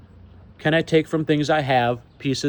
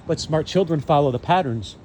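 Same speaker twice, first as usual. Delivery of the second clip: normal talking voice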